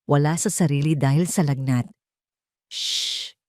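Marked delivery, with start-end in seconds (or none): 2.7-3.3 put-on whispered hush to a sick child; a breathy whispered shh; soft shush. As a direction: shush him gently under your breath. Mother soothing feverish child.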